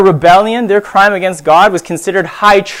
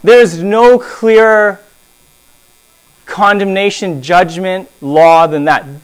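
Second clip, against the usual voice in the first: ordinary talk, nothing marked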